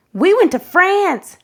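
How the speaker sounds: put-on bright boast from a child; proud classmate. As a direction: announce it like an eager kid. Classmate Chayla answers proudly.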